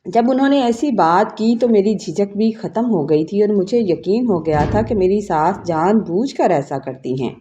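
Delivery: ordinary delivery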